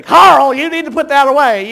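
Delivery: high, loud motherly scold across the church; loud scolding whisper-turned-shout. Retold or imitated. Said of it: "but they heard Carrie".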